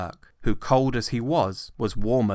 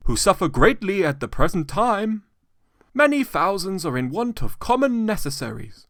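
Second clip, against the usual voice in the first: higher, courteous, lightly ceremonious; polite portly gentleman; 12 semitones higher, 8 dB louder than usual. A narrator's reading. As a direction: address the old man with formal politeness. Gentleman appeals for charity.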